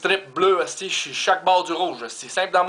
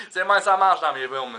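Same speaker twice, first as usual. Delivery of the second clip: ordinary talk, nothing marked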